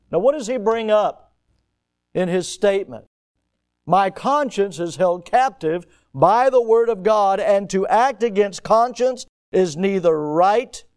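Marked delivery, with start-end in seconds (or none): 3.9-10.8 loud, higher, forceful declamation; declaimed historic speech. Acted. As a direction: proclaim it firmly like a stand at trial. Repeats Luther's statement in declamation.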